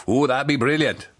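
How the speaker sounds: lightly done Welsh baker's wife; Welsh accent. Acted. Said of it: "Missus Barnaby says".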